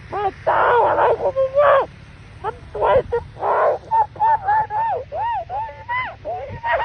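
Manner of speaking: high-pitched, slow amazed squeal; excited squeal. Real, not acted. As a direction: gush over the snow in awe. Overwhelmed by falling snow.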